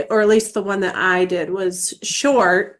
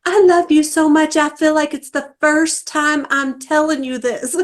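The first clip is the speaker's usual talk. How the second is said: imitating daughter; 7 semitones higher than usual. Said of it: "But she was like".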